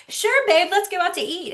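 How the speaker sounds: mock-sweet breezy yes; sweet agreeable. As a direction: play the easygoing wife. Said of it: "Sometimes I'm like".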